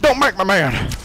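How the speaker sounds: high, loud hammed-up threat; mock furious yell. Gamer yelling mock threats at the giant. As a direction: scream it at the giant.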